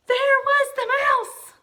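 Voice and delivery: loud, high, slow startled cry; high slow gasping shout. Acted. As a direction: shriek it out in shock. Gasped story climax.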